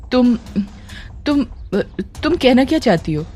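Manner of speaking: a touch of panic, stumbling on the first word; nervous stammer. A narrator's reading. Ananya caught by Tara, mildly nervous stammer. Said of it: "घबरा कर कहा".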